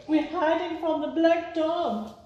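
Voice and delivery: high, hushed frightened voices; family answering Small from under the covers; scared family. Acted. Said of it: "family answering Small".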